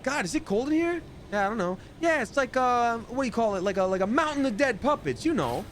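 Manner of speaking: high, chatty small talk; chatty puppet voice. Pretend. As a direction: gossip like a bored puppet.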